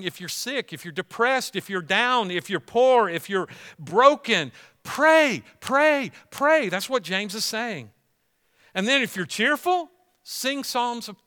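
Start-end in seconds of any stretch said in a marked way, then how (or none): 4.8-6.7 shouted high and loud, each pray hammered; preacher pleading with the suffering to pray; urgent exhortation. Acted. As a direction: drive the word pray home. Preacher urging the congregation.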